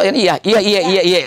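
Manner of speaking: quick, lively Makassar-style iya, iya, iya; Makassar way of saying iya. Retold or imitated. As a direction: rattle off iya iya iya fast like someone from Makassar. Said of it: "kalau orang Makassar kalau iya tuh".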